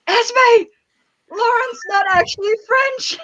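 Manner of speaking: high-pitched mock shock; retold astonishment. Retelling a text to a friend about Lauren, astonished. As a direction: break the news in amazement, pitched high.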